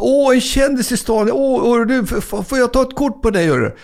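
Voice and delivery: very high, gushing call; excited fan shout. Retold or imitated. Middle-aged woman in Piteå calling out to a star, very high and gushing. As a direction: squeal at a celebrity on the street.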